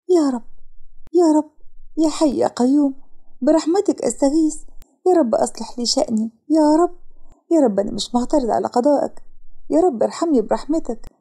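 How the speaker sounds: high, heartfelt supplication; pleading prayer. A narrator's reading. Woman praying after salah for mercy, imploring. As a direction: call on God with earnest, aching pleas.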